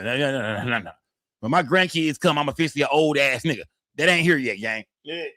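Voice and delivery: ordinary talk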